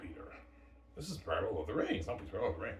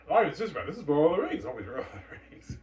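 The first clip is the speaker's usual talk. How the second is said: high, loud gushing; mock-excited director; raving excitement; 9 semitones higher, 8 dB louder than usual. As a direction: gush like a fanboy who's just seen it. Excited director raving over story.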